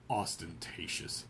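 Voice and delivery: low, slow, under the breath; muttered. Acted. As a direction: mutter it dismissively. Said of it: "he muttered".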